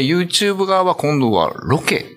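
ordinary delivery

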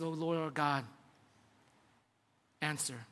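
low, soft plea, falling away; hushed prayer. Retold or imitated. Prophet on his knees praying to God, hushed. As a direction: voice a quiet, reverent prayer.

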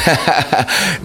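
trailing into a real laugh. Real, not acted. Host laughing after helping the guest on the quiz. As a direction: let the laugh take over.

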